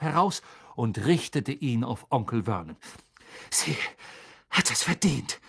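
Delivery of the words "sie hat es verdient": out of breath. A narrator's reading. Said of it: "sagte er nach Atem ringend".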